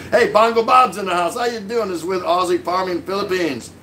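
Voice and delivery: ordinary talk, nothing marked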